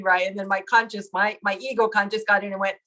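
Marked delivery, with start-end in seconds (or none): none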